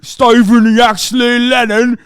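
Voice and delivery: mock British accent. Streamer repeating the name in a mocking British accent. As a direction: draw the name out like a British lad. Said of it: "repeated in accent".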